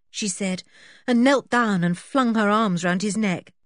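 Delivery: plain reading voice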